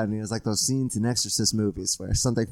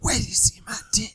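seductive whisper; 7 semitones higher than usual. Acted. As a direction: whisper it close and creepy. Whispered bit repeated.

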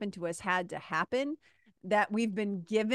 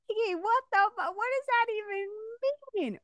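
confused; 13 semitones higher than usual. Retold or imitated. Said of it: "you might be like".

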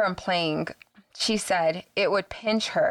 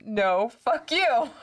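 higher, drawn-out defiant comeback; slow, raised, playful defiance; mock board reply; 5 semitones higher, slower than usual. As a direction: stretch out the board's retort with a lift. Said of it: "and it spelled".

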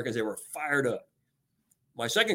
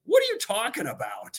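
baffled employee; 8 semitones higher than usual. Retold or imitated. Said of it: "And they're like".

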